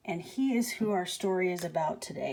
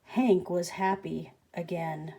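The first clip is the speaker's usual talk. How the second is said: ordinary delivery, nothing marked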